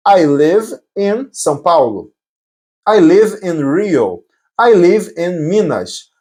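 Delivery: slow, spaced-out example sentences; slow model answers. Shown as a demonstration. Teacher showing answers about where one lives, slowly. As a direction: lay out each answer slowly for learners.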